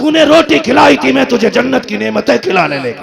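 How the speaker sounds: loud, high triumphant preaching; fervent proclamation. Acted. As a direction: announce the reward like a herald. The one who was fed speaks on Judgment Day.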